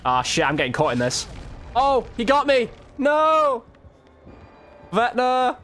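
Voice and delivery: ordinary talk, nothing marked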